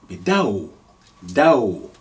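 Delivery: "dao" gliding a into ow; "dao" as one diphthong. Shown as a demonstration. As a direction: let "dao" slide into an ow. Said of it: "If we put sukun on the waw".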